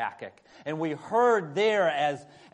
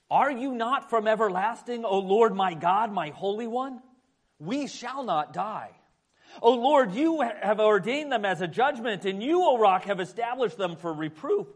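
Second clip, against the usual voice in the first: earnest, raised public reading voice; scripture reading; 4 semitones higher than usual. Acted. Pastor reading Habakkuk to the church, solemn reading. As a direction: proclaim the passage to the congregation.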